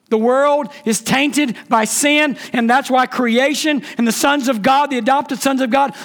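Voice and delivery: high-pitched, rising sermon fervor; impassioned preaching. Real, not acted. Preacher telling congregation creation longs for release, impassioned. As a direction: preach it with mounting force. Preaching building to full force.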